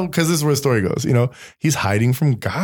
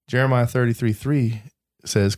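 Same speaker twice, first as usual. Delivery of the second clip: ordinary talk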